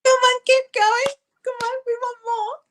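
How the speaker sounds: high excited cheering. Real, not acted. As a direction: egg her on. Cheering singer on.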